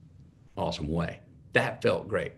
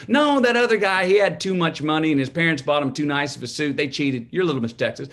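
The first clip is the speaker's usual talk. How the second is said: a hint of an indignant mother, higher; indignant mom; 6 semitones higher, 5 dB louder than usual. Mom explaining why the rival won, lightly indignant. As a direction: dismiss the cheaters with motherly certainty. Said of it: "she goes".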